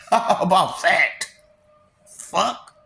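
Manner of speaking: ordinary talk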